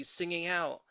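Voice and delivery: usual speaking voice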